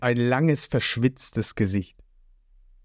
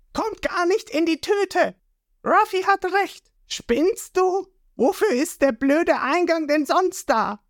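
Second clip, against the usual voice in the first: Nami scolding. A narrator's reading.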